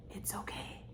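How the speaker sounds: soft whisper of comfort; whispered reassurance. Acted. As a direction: breathe it like a secret. Reassuring girl, then laughs.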